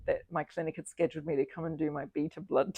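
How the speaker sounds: normal talking voice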